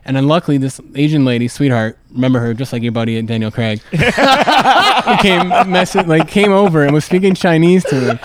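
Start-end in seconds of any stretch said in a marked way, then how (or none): 3.8-5.2 high laugh bursting out; burst of laughter. Real, not acted. Laughing at the Daniel Craig joke while telling the story. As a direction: let a laugh break out, high. Laughs recalling helpful lady.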